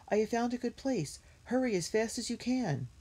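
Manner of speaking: high, pressing call to run; urgent cry. A narrator's reading. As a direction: keep urging them on, high. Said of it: "cried Jessie".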